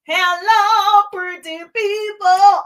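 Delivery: big, high, loud singsong hello; high-energy stretched-out shout-out; called-out greeting. Real, not acted. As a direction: greet the crowd loudly, drawing out the words. Livestream host calls out greeting.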